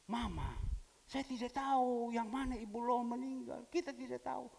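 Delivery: put-on flustered young man. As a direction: voice the confused helper pleading ignorance. Young man answering angry woman.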